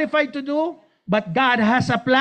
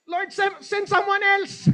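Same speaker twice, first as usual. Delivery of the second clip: mock plea; 9 semitones higher than usual. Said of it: "sabi niya".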